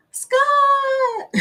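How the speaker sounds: very high ringing call of a name; very high happy call to Scott; excited call. Real, not acted. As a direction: sing out his name with delight. Calling out to viewer.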